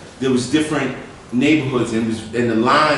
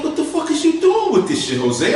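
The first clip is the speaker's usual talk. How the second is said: pitched way up, demanding and stunned; can't believe what he's seeing; high incredulous yell. Retold or imitated. To friend Jose about the drugs, a high stunned demand.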